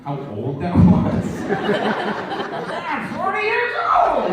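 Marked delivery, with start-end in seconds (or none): 1.5-4.3 high, slow, laughing wail; hammed-up drawn-out cry of being old; mock old lament. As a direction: stretch it out in mock horror. Mocking an old-sounding adult.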